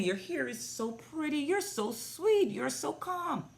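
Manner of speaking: put-on high, sweet baby-talk compliments; cooing praise. Complimenting the little girl's braids and beads, high sweet coo.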